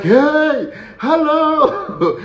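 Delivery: very high, drawn-out greeting; hammed-up, giddy hello; excited catcalling. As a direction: call out like men thrilled to spot a woman. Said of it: "you can see the excitement".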